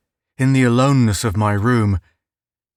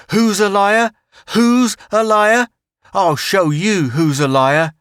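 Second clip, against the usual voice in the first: taunting bully; 7 semitones higher than usual. Acted. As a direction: jeer at him, spoiling for a fight. Bully taunting child in fight.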